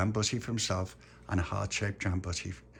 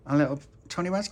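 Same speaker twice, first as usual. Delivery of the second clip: ordinary delivery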